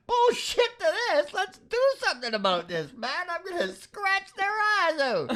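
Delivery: Winnebago man rant. Retold or imitated. The cat plotting revenge as the Winnebago man, high mock fury. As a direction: rant like a furious old crank, half laughing. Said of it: "kinda like me, the Winnebago man".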